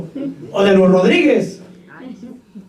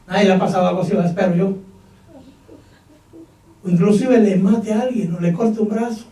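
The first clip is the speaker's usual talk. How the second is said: normal talking voice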